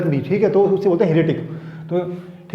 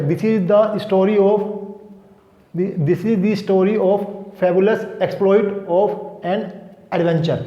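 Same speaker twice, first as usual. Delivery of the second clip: slow dictation read; much slower than usual. Shown as a demonstration. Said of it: "teacher reads sentence slowly".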